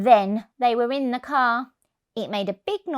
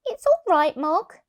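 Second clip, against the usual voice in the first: put-on higher soothing child; child's voice; 9 semitones higher, 4 dB louder than usual. Child comforts cat.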